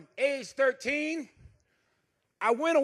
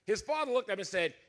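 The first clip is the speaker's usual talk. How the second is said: usual speaking voice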